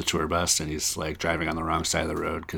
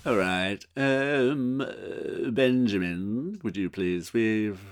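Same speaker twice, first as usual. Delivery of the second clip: slow, hesitant British boss; halting English politeness, drawn out; Rowan Atkinson; 4 dB quieter, slower than usual. Acted. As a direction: deliver bad news with awkward pauses. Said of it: "who can do the best Rowan Atkinson impersonation".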